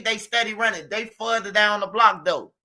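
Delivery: ordinary delivery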